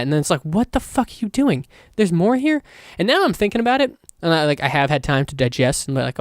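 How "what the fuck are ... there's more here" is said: higher-pitched retold astonishment; incredulous. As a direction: act out being baffled by one more twist. Retold reaction to another plot reveal.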